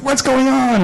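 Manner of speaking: high, puzzled question; startled daughter. Retold or imitated. Daughter rushing in at the commotion, high and startled.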